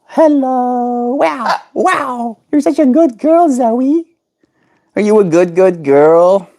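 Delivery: squeaky high pet talk. Real, not acted. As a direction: coo up high at the bird. High baby talk to parrot.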